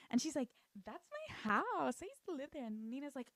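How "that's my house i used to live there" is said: put-on old lady. Old lady telling Nina of her house, high old-lady voice. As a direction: imitate a wistful old woman, pitched up.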